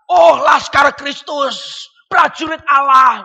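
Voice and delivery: very slow, loud proclamation; mock grand declaration. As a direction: stretch it out like a pompous rallying cry. Mimics grand church declaration.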